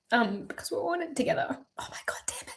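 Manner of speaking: soft, breathy whispered words through tears; real sobbing whisper; crying whisper. Real, not acted. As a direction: whisper as the crying takes over.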